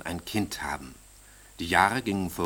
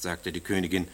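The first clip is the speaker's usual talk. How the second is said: ordinary delivery, nothing marked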